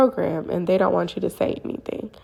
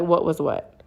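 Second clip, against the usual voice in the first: unfazed retold reply, in usual pitch; deadpan denial. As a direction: play it as if nothing happened. Partner feigns ignorance in reply.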